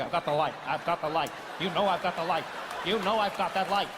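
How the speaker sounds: stare him down with total confidence; cool, mock-confident insistence. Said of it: "he was so confident".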